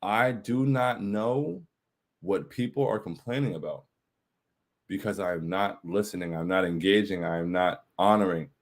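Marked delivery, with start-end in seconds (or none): 0.0-3.8 each word of "I do not know" drawn out; literal emphasis. Real, not acted. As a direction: spell it out slowly and deliberately, "I do not know". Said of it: "I mean that very literally".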